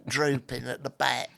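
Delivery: lightly voiced old Cockney man running out of breath; a dry-throated old Cockney, rising at the end; a hint of a wheezy old Cockney. Shown as a demonstration. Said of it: "I could sort of recreate quite faithfully".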